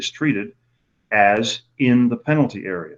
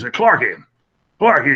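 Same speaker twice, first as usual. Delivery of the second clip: imitating a Southern good old boy, higher and louder; good old boy drawl; 4 semitones higher, 5 dB louder than usual. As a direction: call out his name like a folksy Southern official. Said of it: "he talks like this".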